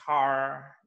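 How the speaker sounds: American "car" with a strong R; American accent. Shown as a demonstration. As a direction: hit a hard American R at the end of "car". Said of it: "they use R in the strong sounds".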